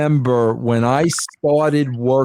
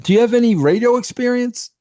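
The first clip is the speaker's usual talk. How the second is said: mock interviewer; 9 semitones higher, faster than usual. Teasing interviewer question.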